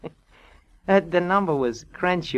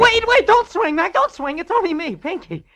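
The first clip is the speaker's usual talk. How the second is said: high, loud, frantic begging; shrill cry not to be hit; panicked plea; 8 dB louder than usual. Acted. Drunk pleads with detective not to swing, panicked.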